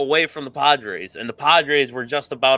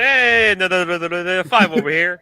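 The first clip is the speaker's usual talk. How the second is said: auctioneer voice; 5 semitones higher than usual. Pretend. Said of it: "I woulda sounded like an auctioneer guy".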